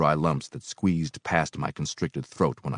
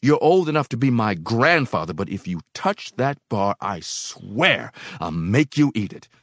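angry warning; 6 semitones higher, 4 dB louder than usual. A narrator's reading. Helper warns old man off bar.

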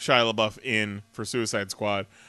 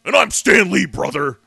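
loud, higher wrestler bluster; Hulk Hogan; 5 semitones higher, 8 dB louder than usual. Acted. Host imitates wrestler greeting a comic creator, hammed-up bluster. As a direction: boom it like a hammy wrestling promo. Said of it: "and Hulk Hogan goes".

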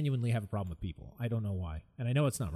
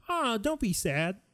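high, slow mock pity; hollow sympathy; 9 semitones higher, much slower than usual. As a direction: imitate a syrupy consoler. Imitating hollow comforting.

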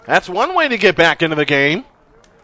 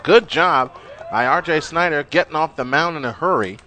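ordinary talk, nothing marked

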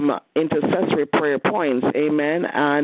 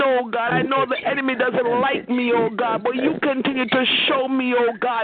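rising, impassioned prayer voice building toward a climax; fervent prayer; 7 semitones higher than usual. Real, not acted. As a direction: church prayer leader pouring out thanks.

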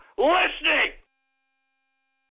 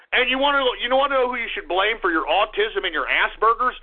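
ordinary talk

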